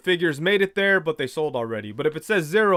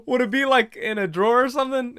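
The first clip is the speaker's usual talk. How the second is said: laughing question; 6 semitones higher than usual. Retold or imitated. Asking clerk, still laughing.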